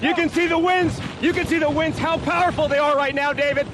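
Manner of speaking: projecting hard over a storm; shouting over wind. Real, not acted. Reporter live in hurricane winds.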